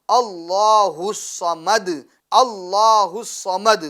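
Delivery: slow recitation with a full, heavy لام in Allah; tajweed recitation. Shown as a demonstration. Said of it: "اس کو ہم کیا کریں گے؟ ... پور پڑھیں گے". (اللہ کا لام پور، آہستہ تلاوت)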